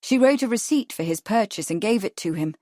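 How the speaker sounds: ordinary delivery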